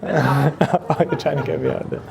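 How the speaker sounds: laughing. Real, not acted. Laughing at the interruption.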